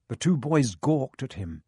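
usual speaking voice

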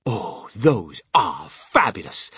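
slow, loud, savouring exclamation; rapturous cry. A narrator's reading. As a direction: gush with drawn-out relish. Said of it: "cried Mister Wonka".

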